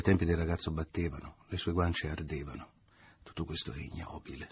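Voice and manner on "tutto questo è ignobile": much softer, inward disgust; a thought said under the breath in disgust. A narrator's reading. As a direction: pensiero disgustato, sottovoce. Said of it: "pensava disgustato".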